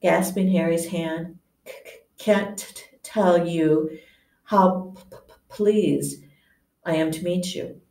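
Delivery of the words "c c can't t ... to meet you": nervous, stuttering delivery; stammering. Acted. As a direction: trip over every word. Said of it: "stammered Professor Quill".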